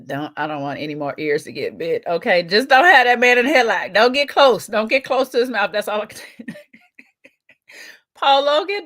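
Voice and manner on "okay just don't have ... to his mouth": loud warning. Real, not acted. Warning the fighter.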